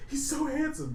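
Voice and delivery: high, lightly hammed-up whimper; mock weepy line. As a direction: tease Devon with the weepy line. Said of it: "say that to Devon".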